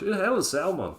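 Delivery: a quick Scottish impression; Scottish accent. Mimicking the Scottish wrestler in a Scottish accent. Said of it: "he said it in Scottish".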